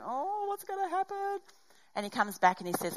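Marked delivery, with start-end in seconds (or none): 0.0-1.4 high, anxious fretting; mock anxious fretting while waiting in the car; stewing worry. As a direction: whine the worry to yourself. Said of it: "you're sitting there stewing going".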